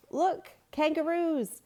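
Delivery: high, loud, slow cry of delight; excited boy. A narrator's reading. Boy spotting kangaroos with friends, high amazed call. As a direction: call out the find in wonder. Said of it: "Luke pointed".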